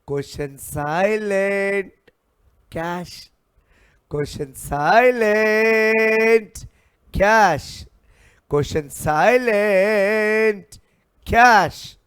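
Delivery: loud, high, stretched-out rule; emphatic classroom chant; drilled refrain. Real, not acted. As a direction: hold the key word long, then punch the answer.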